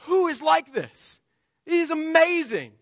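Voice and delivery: high, loud cry of wonder; awestruck exclamation. Acted. Worshipper among angels praising God, awestruck. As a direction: marvel aloud, drawing out the words.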